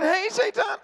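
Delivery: high, gleeful sing-song hello; friendly mock greeting. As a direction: interrupt yourself with a high hello. Greets the devil again.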